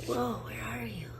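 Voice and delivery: hushed whisper to a small creature. Real, not acted. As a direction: whisper so you don't scare it off. Spots an animal nearby.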